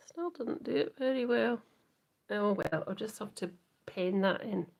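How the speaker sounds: ordinary talk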